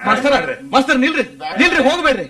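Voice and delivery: high, urgent call to stop; urgent pleading. Acted. Host calling after offended guests, urgent high plea. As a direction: plead with them not to leave.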